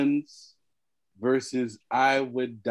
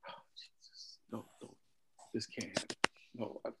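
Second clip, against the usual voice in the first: despairing whisper; 5 semitones lower, 18 dB quieter than usual. Real, not acted. Despair as votes go wrong.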